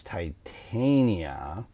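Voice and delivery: "Titania" as tie-TAIN-ya; "Titania" with long i and long a; common pronunciation. Shown as a demonstration. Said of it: "what a lot of people say".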